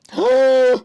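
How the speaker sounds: sharp high gasp of delight; excited gasp. Acted. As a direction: gasp as if at a treasure. Excited over building the set.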